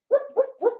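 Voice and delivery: high loud whoop whoop siren; siren imitation. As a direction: sound off like a cop car.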